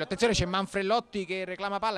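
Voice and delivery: ordinary talk, nothing marked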